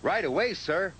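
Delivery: eager. A narrator's reading. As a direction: snap to it happily. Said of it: "whistled Thomas".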